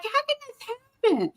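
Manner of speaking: very high dismayed cry; disbelief. Retold or imitated. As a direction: wail in disbelief. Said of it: "And I'm just like".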